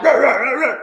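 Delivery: loud cartoon Tasmanian devil growl; growling devil noise. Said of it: "He like".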